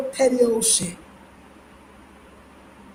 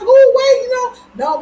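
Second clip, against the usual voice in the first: mock surprise; 12 semitones higher, 5 dB louder, slower than usual. Friend's surprised reply, retold.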